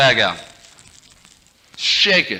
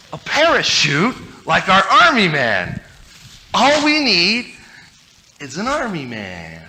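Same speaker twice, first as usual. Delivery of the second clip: slow, higher coaxing pitch; persuading kid; 6 semitones higher, slower than usual. Retold or imitated. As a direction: sell the idea to a reluctant brother. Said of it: "I said".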